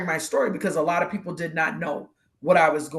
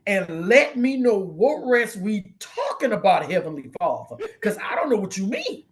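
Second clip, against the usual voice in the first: exasperated mock pleading; 4 semitones higher than usual. Begging Heavenly Father to lean down and explain, exasperated. Comically pleads with God for clarification.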